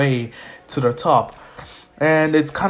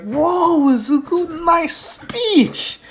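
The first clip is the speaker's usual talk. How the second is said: high-pitched excited praise; wowed, pitched way up; impressed classmate; 13 semitones higher, much slower than usual. Acted. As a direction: gush in a raised, acted voice.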